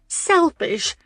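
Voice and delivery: high, stunned echo of the word; gasp of disbelief. A narrator's reading. Lauren echoing Alex's insult, high and disbelieving. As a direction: throw the word back, incredulous. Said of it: "she gasped in disbelief".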